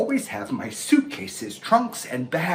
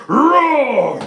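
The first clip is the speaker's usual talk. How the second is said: loud puppet roar; lion roar; 10 semitones higher, 10 dB louder than usual. Acted. Lion demanding his breakfast, loud roar.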